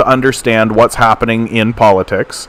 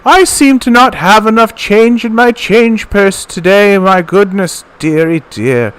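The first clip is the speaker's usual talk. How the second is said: put-on sweet old lady voice; 8 semitones higher than usual. Streamer imitating Mom's public persona, high sweet old lady. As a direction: coo it like a doting grandma. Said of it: "Then she talks like this".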